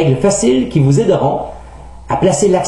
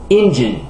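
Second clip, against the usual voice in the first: "Engine" stressed on EN; first-syllable stress; 3 semitones lower than usual. Shown as a demonstration. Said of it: "l'accent est normalement sur la première syllabe". (« Engine » accentué sur la première syllabe)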